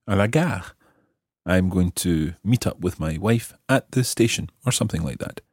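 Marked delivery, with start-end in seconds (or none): none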